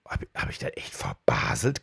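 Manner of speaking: unvoiced whisper, low and doubtful; whispered self-doubt. Alone at his desk, doubting himself, whispered. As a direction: whisper it to yourself, unsure.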